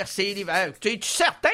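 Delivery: ordinary talk, nothing marked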